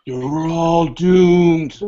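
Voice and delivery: slow ominous raspy drawl; creepy doomsday warning, drawn out; Crazy Ralph impression. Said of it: "a Walt Gorney Friday the Thirteenth reference".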